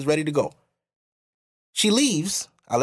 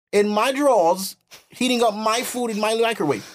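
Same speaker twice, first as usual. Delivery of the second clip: higher-pitched indignant rant; re-enacted outrage, pitch raised; indignant retort; 6 semitones higher than usual. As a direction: argue your case, affronted.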